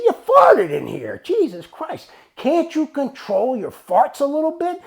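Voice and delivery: loud, high, drawn-out telling-off; acted outrage, slow and loud; exasperated scolding. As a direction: chew him out in disbelief. Acted scolding of a farting employee.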